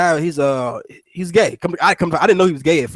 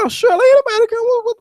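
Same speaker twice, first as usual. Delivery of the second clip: squeaky fast clueless tone; high mock naive voice; faster than usual. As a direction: play it high, quick and oblivious. Mocking own clueless reply.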